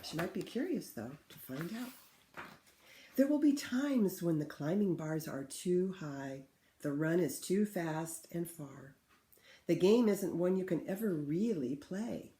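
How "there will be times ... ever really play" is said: put-on clear, louder read-aloud for children; story reading. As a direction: read the book to the class with feeling. Teacher reads picture book aloud.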